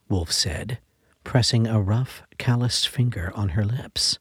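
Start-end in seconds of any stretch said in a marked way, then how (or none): none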